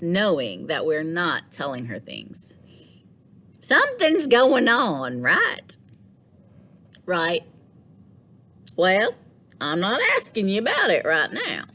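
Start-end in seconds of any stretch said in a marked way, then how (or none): 3.6-11.6 a gently probing grandmother. Acted. Gran voiced in story.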